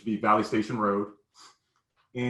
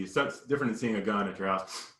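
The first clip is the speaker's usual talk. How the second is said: ordinary delivery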